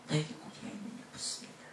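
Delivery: the Lord's question repeated in a hush; whispered repeat. Acted. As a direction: whisper the question again, unvoiced.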